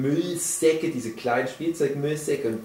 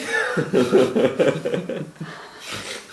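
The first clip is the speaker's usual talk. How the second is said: laugh; 3 semitones higher than usual. Real, not acted. Laughing at the joke.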